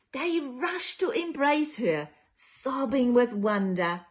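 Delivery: lively picture-book storytelling; reading to children. A narrator's reading. Storyteller reading to children, lively and sing-song. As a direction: read it like bedtime story time for little ones.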